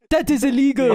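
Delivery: lightly imitated South African accent; a hint of a South African accent. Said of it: "a South African accent he kept doing".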